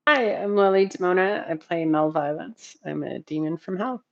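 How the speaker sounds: ordinary talk, nothing marked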